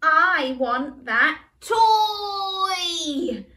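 high tearful shout, drawn out; crying demand. Acted. Little brother cries for the toy, loud tearful wail. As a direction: wail it like a toddler in tears. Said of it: "exclaimed Matthew through tears".

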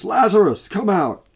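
high, loud call into the tomb; loud cry. Acted. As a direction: cry out his name to raise the dead. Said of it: "he cried out with a loud voice".

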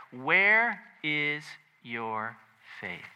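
very slow, word by word; slow, weighty question to ponder; contemplative question. Retold or imitated. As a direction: leave space after every word. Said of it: "contemplate that question".

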